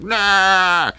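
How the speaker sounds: loud, high mock yell, drawn out; hammed-up holler of an angry driver; imitated shout. Guy in the truck shouting at him, mock yell. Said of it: "the guy that was shouting at me in the truck".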